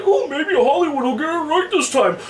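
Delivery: high, mock-hopeful gamer voice; lightly mocking starry-eyed fan. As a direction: mimic a naive fan getting his hopes up. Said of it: "get their hopes up too high, like".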